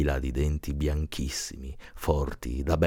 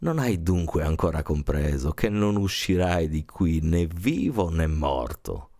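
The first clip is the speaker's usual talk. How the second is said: ordinary delivery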